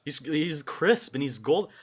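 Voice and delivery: ordinary talk, nothing marked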